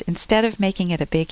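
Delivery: usual speaking voice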